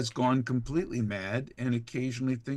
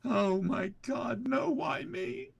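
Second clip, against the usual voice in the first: much higher, slow, wailing; fearful Vault Dweller; 14 semitones higher, slower than usual. Acted. Vault Dweller wailing in despair, much higher and slow. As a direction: whimper it out in despair. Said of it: "Vault Dweller Fearful".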